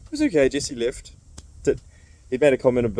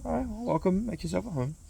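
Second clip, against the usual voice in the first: lightly voiced welcome; 9 semitones higher, 3 dB quieter than usual.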